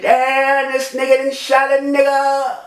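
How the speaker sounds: high, held wail of disbelief; shocked cry. Retold or imitated. Man realises he has just been shot, shocked cry. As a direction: cry it out high, stretching the end. Reenacts crying out when shot.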